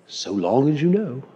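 put-on soft English-accented nobleman; quiet reply in an English accent. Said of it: "He didn't say it with an English accent".

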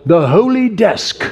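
a touch of a mock Spurgeon accent. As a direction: lightly put on Spurgeon's old preacher accent. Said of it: "That's my best Spurgeon accent".

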